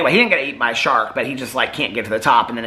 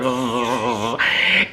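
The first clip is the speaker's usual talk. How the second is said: imitated electric zap noise; taser buzz. Shark getting tased, imitated buzz.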